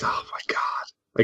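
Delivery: exasperated breathy whisper. Real, not acted. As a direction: whisper it, defeated. Gives up, exasperated.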